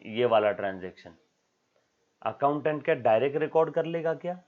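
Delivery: usual speaking voice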